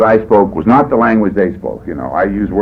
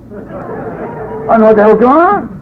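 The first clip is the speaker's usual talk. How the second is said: high, slow bewildered voice; drawn-out bafflement; 11 semitones higher, slower than usual. Retold or imitated. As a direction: stretch it out in confusion. Bewildered aside.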